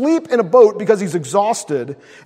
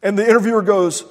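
ordinary delivery

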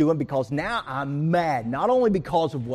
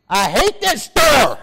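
loud, high, slow furious shout; frustrated outburst; 10 semitones higher, 12 dB louder, slower than usual. Retold or imitated. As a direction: blow up at the store in a yelling rant. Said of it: "And then I get frustrated".

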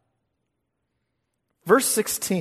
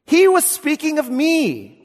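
voicing Jesus; 10 semitones higher, 6 dB louder than usual. Jesus voiced, high and loud.

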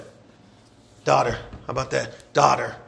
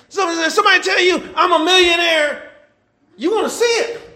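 higher, louder, fast preaching with bursts of shouting; preaching at full force; 7 semitones higher, 4 dB louder than usual. Acted. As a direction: fire up the congregation, pushing hard.

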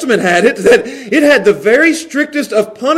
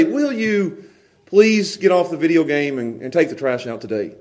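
soft, low, polite asking; gentle request; 5 semitones lower, 5 dB quieter than usual. Shown as a demonstration. Father asks his boys to do chores, gentle request. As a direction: ask meekly, voice dropping away. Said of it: "there's a difference".

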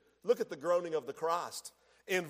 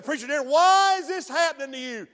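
mock challenge; 6 semitones higher, 8 dB louder than usual. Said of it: "You may say".

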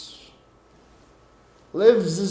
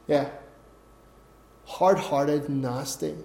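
usual speaking voice

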